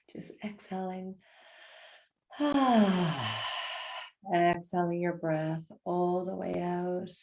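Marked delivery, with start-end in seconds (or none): 2.3-4.3 loud falling sigh; dramatic sigh. Shown as a demonstration. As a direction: let out the biggest sigh. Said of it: "with the biggest sigh".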